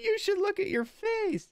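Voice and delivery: put-on high-pitched amused kid; very high, tickled child voice; giggly child. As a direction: tease big brother in a little boy's squeal. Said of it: "he starts giggling".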